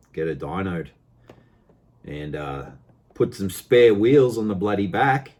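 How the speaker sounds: normal talking voice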